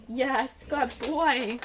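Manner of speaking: slow, drawn-out praise for a puppy. Real, not acted. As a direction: coo it slowly to a pet. Talking to her dog Milo.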